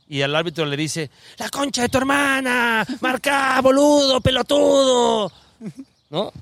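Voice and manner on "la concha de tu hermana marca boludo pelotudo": furious mock shout at the referee, high-pitched; hammed-up high, loud heckling; very loud yelling. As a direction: bellow Argentine insults from the next bench, drawing out the end. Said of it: "Sí, muy fuerte".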